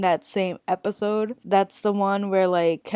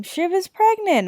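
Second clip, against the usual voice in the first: high and loud, a fake dramatic reveal; mock bombshell announcement; 11 semitones higher, 4 dB louder than usual. Vlogger teasing her viewers with a fake big reveal, mock dramatic. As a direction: announce it like huge news, then undercut it.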